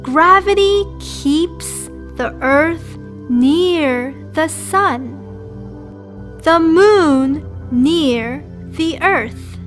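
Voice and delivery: usual speaking voice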